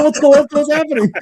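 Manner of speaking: high, loud mock-eager questions; retold excitement. Fan retelling his reaction to friend's hype, excited.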